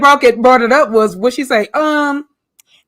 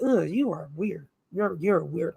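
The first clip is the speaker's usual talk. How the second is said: disgusted quote; 6 semitones lower, 9 dB quieter than usual. Retold or imitated. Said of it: "I was like".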